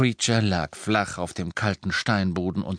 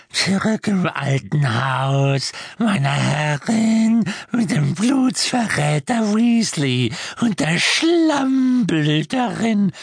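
high, slow, loud croak rising ever higher; house-elf grumbling about the guests to his knees; croaking elf mutter; 10 semitones higher, 6 dB louder, slower than usual. A narrator's reading. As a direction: spit the insults like a resentful old elf. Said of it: "murmelte zu seinen Knien".

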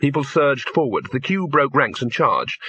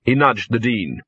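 ordinary delivery

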